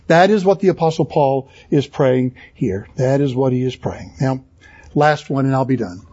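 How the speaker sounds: ordinary talk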